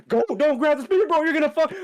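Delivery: high, loud mock panic; frantic warning. Kawaru begging Shinji off the spear, frantic loud mock warning. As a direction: yell an urgent retold warning.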